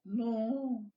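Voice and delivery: squeaky high "no" said softly; a feeble high "no" from the losing side; tiny high no. Pretend. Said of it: "वो बोलिए". (पतली ऊँची धीमी आवाज़ में "नो")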